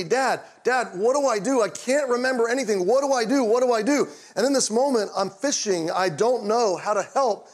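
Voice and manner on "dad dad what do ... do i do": higher, louder pleading, a mock panicked son; retold panic. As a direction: beg your dad for help in a rising panic. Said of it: "he's panicked".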